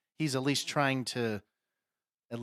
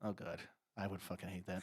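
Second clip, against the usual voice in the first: quiet disgust; 6 semitones lower, 12 dB quieter than usual. Real, not acted. Disgusted low reaction.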